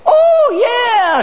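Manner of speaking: a yelped oh, then yeah dropping; a lightly voiced woman caught off guard; surprised oh yeah. Married woman's surprised answer.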